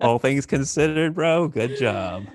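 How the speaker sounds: slow, lightly voiced God; casual God voice. God greeting him at the pearly gates, lightly played. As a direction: draw it out as a laid-back God. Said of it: "to hear God say".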